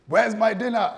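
high, loud mock demand; hammed-up spouse calling for dinner. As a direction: bark out a lampooned demand. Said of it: "When they say".

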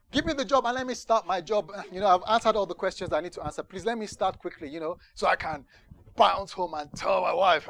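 loud, high, eager rush of pleading; impatient eagerness. Retold or imitated. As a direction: blurt out impatient inner thoughts, loudly. Acts out impatient thoughts at interview.

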